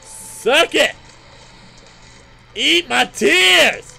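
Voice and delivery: high, loud gloating taunt; taunting yell. Real, not acted. Gamer taunting the boss, loud gloating yell. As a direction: crow it right at the enemy.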